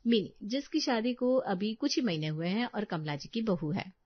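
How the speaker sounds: ordinary delivery, nothing marked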